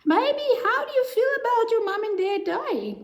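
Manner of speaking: put-on high-pitched, child-directed question; therapist addressing a child. Therapist asks the baby puppet about its parents, high-pitched.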